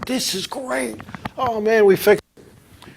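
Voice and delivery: relieved joy. Retold or imitated. Racer's joy at a low temperature.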